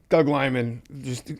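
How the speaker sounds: normal talking voice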